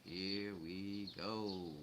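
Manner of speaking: very slow, stretched-out "Here we go"; drawn-out knowing repeat. Retold or imitated. Recalling the FCC trouble coming, slow drawn-out "Here we go". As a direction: draw out each word of "Here we go". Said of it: "I'm like".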